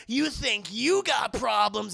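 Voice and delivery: hammed-up movie one-liner; mock action-hero retort. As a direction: throw it back with stressed, slow bravado. Harry answers mid-battle, mocked.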